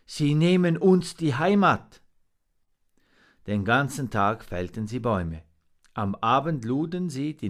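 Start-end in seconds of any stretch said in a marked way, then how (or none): 0.1-2.0 raised, loud cry of dismay; groaned lament. A narrator's reading. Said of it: "stöhnte der Rabe".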